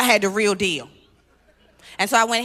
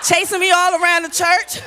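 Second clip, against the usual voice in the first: high, loud burst of disbelief; loud laughing exclaim; 9 semitones higher, 7 dB louder than usual. Real, not acted. Comic telling the crowd about the blanket chase, shouting.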